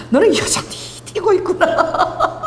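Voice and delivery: high, drawn out, dissolving into laughter; amused laughing remark. Acted. Jesus to ever-impulsive Peter, amused and laughing. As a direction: laugh through the words, stretching them.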